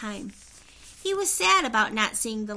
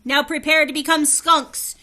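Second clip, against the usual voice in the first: higher, very loud royal threat; furious shout; 6 semitones higher, 11 dB louder than usual. A narrator's reading. Angry king threatening Jack and Annie, shouting high and loud. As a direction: roar the curse down on them. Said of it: "the High King shouted".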